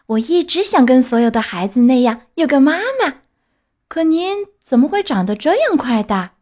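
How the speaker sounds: put-on high, excited child puppet voice; overjoyed Pinocchio. Puppet learns fairy can be his mother, overjoyed. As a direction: gush happily like a little boy.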